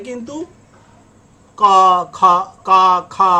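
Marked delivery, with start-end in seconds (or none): 1.6-3.3 put-on loud, drawn-out baby syllables; loud mock baby babble; child's first sounds. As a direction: imitate a toddler sounding out syllables, loudly. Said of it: "একটা বাচ্চা যখন কথা বলে".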